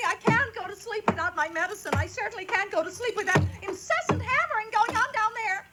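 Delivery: high, nagging complaint; shrill invalid wife. Acted. Invalid wife nagging her husband, high and whiny. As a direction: whine at him from the sickbed. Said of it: "his wife shouted at him".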